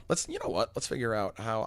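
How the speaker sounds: normal talking voice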